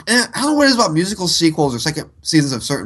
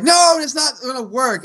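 higher-pitched mock protest; put-on objection in a raised voice; acted protest; 7 semitones higher than usual. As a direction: act out a protesting cry, pitched up. Acted quote from opening song.

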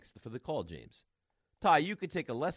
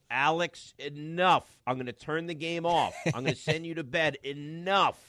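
louder, higher stern scolding from another room; wife scolding; 5 semitones higher, 7 dB louder than usual. Retold or imitated. Wife scolding son from the other room, loud and stern. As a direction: call out sharply to stop the kid. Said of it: "Sherry's like".

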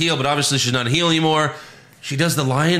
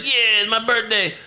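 high-pitched gleeful imitation; hammed-up delight; mock excited reply; 8 semitones higher than usual. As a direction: answer in a put-on high voice. Retold reply of birthday girl.

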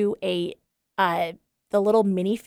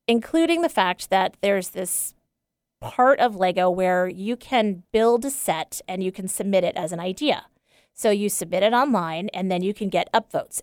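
usual speaking voice

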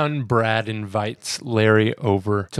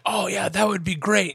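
eager Larry; 8 semitones higher than usual. Retold or imitated. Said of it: "Larry's like".

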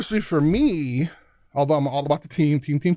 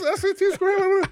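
much higher changed voice, suddenly doubtful; worried recruiter. Retold or imitated. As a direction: ask it like the deal just died. Coach suspecting poor academics.

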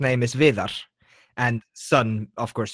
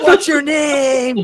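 loud, high, drawn-out holler; shouted punchline; 11 semitones higher, 9 dB louder, much slower than usual. Pretend. Acting out sis in Iceland asking names, shouted. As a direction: yell it out slowly through laughter.

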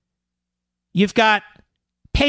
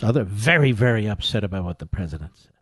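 low mock-grave delivery, trailing softer; mock upset; 11 semitones lower, 3 dB quieter than usual. Host mocking upset news hosts, low and mock-solemn. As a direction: sink into feigned solemn dismay.

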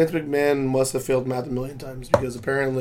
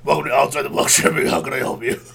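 gravelly customer service voice; 4 dB louder than usual. Pretend. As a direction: rumble like a gravelly clerk. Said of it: "be like".

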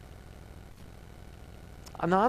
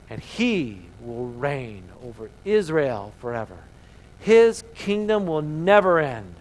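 the angel proclaiming to Mary, slow and majestic; Gabriel's proclamation; slower than usual. Acted. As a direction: announce it like a herald from heaven. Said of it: "Gabriel saying this".

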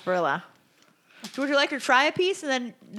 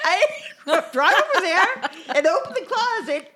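laughing through the words; 8 semitones higher, 7 dB louder, slower than usual. Real, not acted. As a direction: giggle while telling it.

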